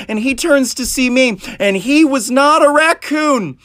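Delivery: normal talking voice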